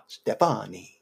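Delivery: mock Italian, drawn out; playful Italian-style name call; Italian accent. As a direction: say her name like an Italian charmer. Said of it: "you did an Italian accent".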